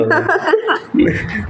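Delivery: high-pitched burst of laughter. Real, not acted. Teasing friend about crying. (ऊँची हँसी)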